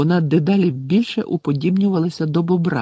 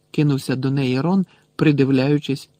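plain reading voice

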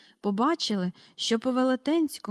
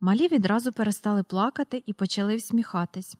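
ordinary delivery

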